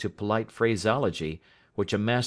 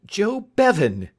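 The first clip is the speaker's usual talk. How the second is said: higher, louder, slower cry of recognition; delighted exclamation; 11 semitones higher, 8 dB louder than usual. A narrator's reading. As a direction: cry out the name in glad surprise. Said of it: "cried Mister Spence".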